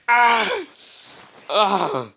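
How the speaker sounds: high, loud mock scream; imitated pained scream. Neji as his arm gets broken, imitated scream. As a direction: cry out as if the arm just broke. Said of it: "And Neji screams".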